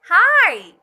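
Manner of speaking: Hi! high, loud, vowel stretched; excited long hi. Shown as a demonstration. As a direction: greet a friend you're thrilled to see. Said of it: "Like this".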